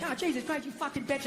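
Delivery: very soft, muttered under the breath; muttered insult. Retold or imitated. Husband cursing his wife, very soft mutter. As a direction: barely voice it, mock. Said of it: "go like".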